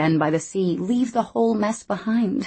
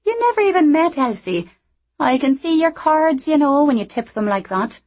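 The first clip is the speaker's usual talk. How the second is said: higher, brighter character voice; lively young Scottish woman; 9 semitones higher, 6 dB louder than usual. Acted. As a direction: play her cheeky and quick. Anna voiced.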